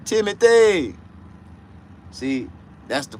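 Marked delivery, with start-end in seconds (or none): 0.1-0.9 calling out. Real, not acted. Calling out a name.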